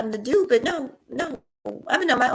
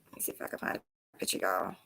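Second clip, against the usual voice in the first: muttered whisper while searching; 15 dB quieter than usual. Real, not acted.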